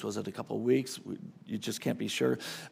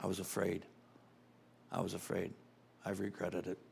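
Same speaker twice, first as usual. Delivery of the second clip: much softer and lower, a hint of regret; quiet confession; 4 semitones lower, 7 dB quieter than usual. Francis Chan confessing to young people, softly. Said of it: "He confessed openly".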